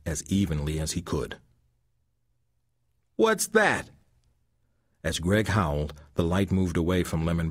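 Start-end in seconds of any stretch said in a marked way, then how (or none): 3.2-3.9 much higher startled cry; startled thug. A narrator's reading. Thug spooked by the howl in the dark, startled. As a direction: jump at the strange howl.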